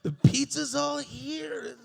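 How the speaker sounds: high, loud, slow exclamation; mock excitement. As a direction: stretch it out, delighted. Excited at the pizza.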